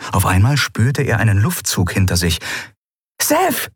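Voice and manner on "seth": high piercing shout of a name; scream. A narrator's reading. Amalin warning Seth, high alarmed scream. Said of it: "schrie Amalin".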